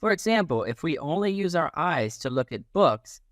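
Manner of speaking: ordinary delivery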